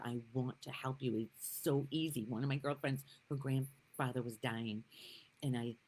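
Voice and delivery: usual speaking voice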